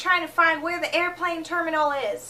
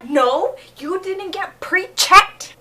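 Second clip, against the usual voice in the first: slow, louder, firm refusal; stern official; 4 semitones higher, 7 dB louder, slower than usual. Retold or imitated. Security agent denying the parents, slow and firm. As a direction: lay down the rule like an official.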